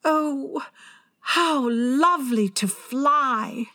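high, slow, breathless wonder; rapturous. A narrator's reading. Wendy imagines flying with Peter, rapturous. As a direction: sigh it out dreamily, high-pitched. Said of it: "she exclaimed rapturously".

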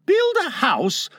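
high-pitched incredulous question; exclaiming. A narrator's reading. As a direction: exclaim it in surprise, voice leaping up. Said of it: "exclaimed John".

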